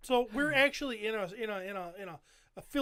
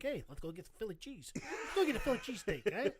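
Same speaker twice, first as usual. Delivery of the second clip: imitating a Philly pal in hammed-up accent; put-on Philadelphia local. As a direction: suggest it in a mock Philly accent. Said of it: "the first thing Anthony did".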